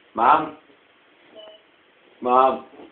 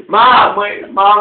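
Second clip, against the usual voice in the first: loud call across the house, higher; shouting; 6 semitones higher, 13 dB louder, slower than usual. Real, not acted. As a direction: yell for mom. Calling out to mom.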